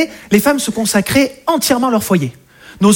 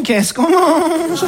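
very slow, drawn-out, higher question; tentative asking; 4 semitones higher, much slower than usual. Acted. Husband today asks new-generation woman about dinner, very drawn-out timid question. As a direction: stretch the question out cautiously.

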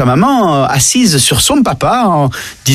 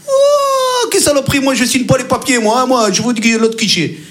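high outraged yelp, then grumbling; a stubborn customer refusing to sign; exasperated customer; 5 semitones higher than usual. Acted. Client exaspéré à la banque. (client exaspéré, cri aigu)

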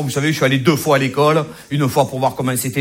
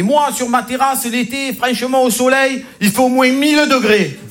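boastful colleague; 7 semitones higher than usual. Retold or imitated. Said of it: "il m'a dit".

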